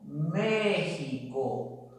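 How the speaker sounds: slow model word; slow careful demo. Shown as a demonstration. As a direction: dictate México slowly to the class.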